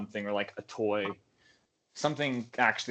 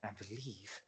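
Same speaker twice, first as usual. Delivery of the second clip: hushed, much softer aside; quiet mutter; 3 semitones lower, 16 dB quieter, slower than usual. Acted. Player quietly mutters to Eric, a hushed aside. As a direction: mutter it under your breath. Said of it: "quietly mutter to him".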